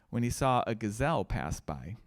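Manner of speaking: ordinary delivery, nothing marked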